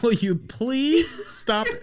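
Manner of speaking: high, slow mock plea; pleading coworker. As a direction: beg him to stop, laughing.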